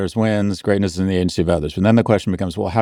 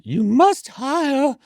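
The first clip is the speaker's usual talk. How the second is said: very high, drawn-out British woman impersonation; slow, commanding British falsetto; Joanna Coles; much slower than usual. Retold or imitated. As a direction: put on an exaggerated high-pitched British lady voice. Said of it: "That's my British, my woman's British impersonation".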